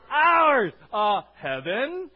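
very loud, drawn-out exclamation; high, full-force preacher's cry; triumphant shout. Real, not acted. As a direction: shout the answer to rally the crowd. Answers own rallying question, fired up.

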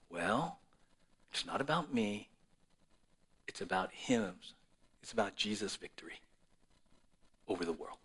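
soft, low and hushed, sinking to a whisper. Acted. Preacher hushing the room to say it is about him, soft and low. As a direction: let it sink to near silence.

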